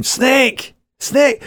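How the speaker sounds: high panicked screech of snake; high shriek. Retold or imitated. Wife shrieking at the garden snake, high and panicked. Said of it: "she lets out a scream".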